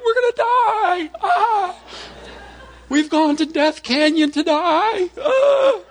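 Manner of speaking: high, loud mock-panicked wailing; worked-up child. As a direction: shriek like a hysterical kid, hammed up. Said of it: "he starts getting worked up".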